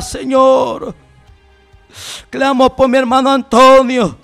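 ordinary talk, nothing marked

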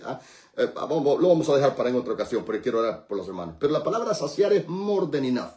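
usual speaking voice

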